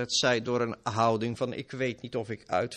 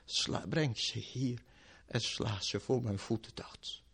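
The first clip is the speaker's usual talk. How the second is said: grim whisper; 2 semitones lower, 7 dB quieter than usual. Acted. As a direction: breathe the verdict. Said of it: "Maar dan zal hij zeggen".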